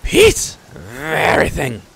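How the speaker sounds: very high, loud kobold echo; puffed-up little creature squawk; proud crier. Acted. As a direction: squawk it proudly, parroting the chief. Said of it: "The crier swells with pride".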